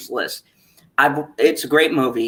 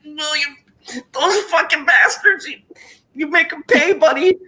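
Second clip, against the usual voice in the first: much higher voice, choked-up cheering; mock tearful rallying; 11 semitones higher than usual. Retelling talking to the screen, high choked-up cheer. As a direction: urge William on like a tearful fan. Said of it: "I'm like".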